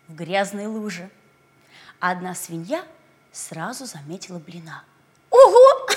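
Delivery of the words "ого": high squeal of surprise; surprised. Acted. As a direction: squeal with delight. Pig spots the pancake.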